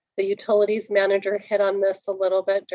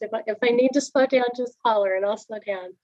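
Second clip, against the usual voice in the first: quick, higher-pitched explanation; rapid, slightly raised voice, rushing to get through; talking fast; 5 semitones higher, 3 dB louder, faster than usual. Real, not acted. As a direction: hurry through it so nobody is held up. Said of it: "I know I'm talking fast".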